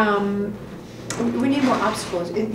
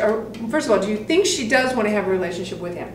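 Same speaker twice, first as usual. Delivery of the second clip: ordinary delivery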